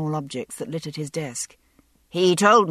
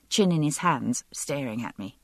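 ordinary delivery

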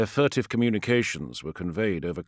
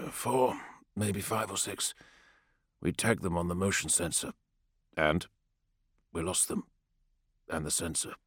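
quiet low-breath report; 6 dB quieter than usual. A narrator's reading. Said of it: "Tarkan spoke quietly, with minimum exhalation".

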